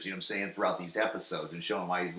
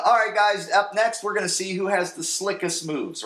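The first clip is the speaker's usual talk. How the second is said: higher, louder hammed-up emcee; party host announcer; 10 semitones higher, 7 dB louder than usual. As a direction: hype the crowd like an emcee. Host [name] announces.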